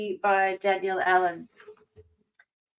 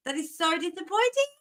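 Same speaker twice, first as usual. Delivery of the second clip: higher, whiny lament over a broken charger; mock-dramatic lament; 5 semitones higher than usual. Real, not acted. Streamer whining to viewers about the broken charger, mock despair. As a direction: ham up the disappointment with a wail.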